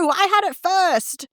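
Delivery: high mock kid shout; sibling fighting over a toy, mock shout; child shouting back. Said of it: "the other's shouting back".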